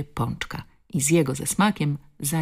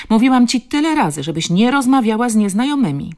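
raised, high-pitched telling-off; scolding grandmother; 8 semitones higher, 8 dB louder than usual. Acted. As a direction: scold the child loudly, exasperated. (podniesiony głos karcącej babci)

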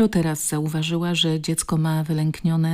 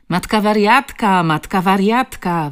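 loud, higher jeering yell; yelled taunt; 4 semitones higher, 7 dB louder than usual. A narrator's reading. Bully to the girl before his pals, yelled taunt. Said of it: "wydarł się najwyższy z grupki".

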